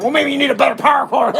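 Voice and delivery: high, laughing mock of the clerk. Customer mocking the Lowe's assistant manager, high and laughing. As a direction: parrot her line with a laugh. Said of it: "She's like".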